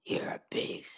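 breathy whispered beast voice; deep distorted monster roar. Pretend. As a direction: rasp it out like a monster. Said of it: "give me your deep "You're a beast" voice".

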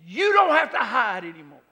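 high and loud, slow, building preacher's cry; preaching at full force. Acted. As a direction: proclaim it over the crowd, swelling to the top. Preacher's climactic altar call.

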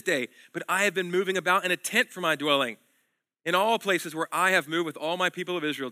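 preacher reading God's promises aloud with force; scripture reading. Acted. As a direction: declaim God's words to the congregation. Reads God's words in scripture.

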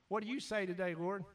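usual speaking voice

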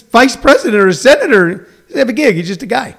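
ordinary talk

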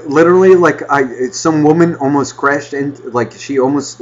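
usual speaking voice

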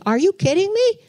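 can't believe what she's seeing; stunned disbelief. Retold or imitated. Wife watching husband run to the altar, incredulous. Shocked seeing Don go forward.

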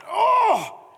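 very high, loud startled shout; pained yelp. Retold or imitated. Husband's back strained by wife on tiptoes, startled yelp. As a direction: yelp as the weight hits.

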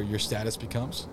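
usual speaking voice

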